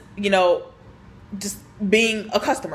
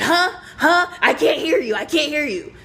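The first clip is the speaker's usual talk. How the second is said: higher-pitched mock hard-of-hearing complaint; mimicked cranky customer; 6 semitones higher than usual. Older customer cuts off the cashier, crankily mimicked. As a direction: imitate an older customer refusing to listen.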